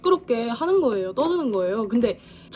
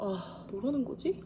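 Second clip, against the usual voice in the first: dazed mutter; 12 dB quieter, slower than usual. Retold or imitated. Dazed by embarrassing remark.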